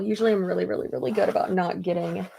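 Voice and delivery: ordinary delivery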